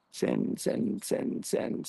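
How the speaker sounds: urgent whispered chant; whispering. Real, not acted. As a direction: whisper it fast to yourself. Urging viewers to share the stream.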